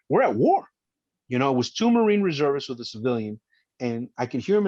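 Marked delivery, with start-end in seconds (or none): 0.1-0.7 high, loud burst of alarm; urgent realization. Retold or imitated. Officer thinking as the Marines arrive, urgent. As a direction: snap into battle mode. Retold inner thought, urgent.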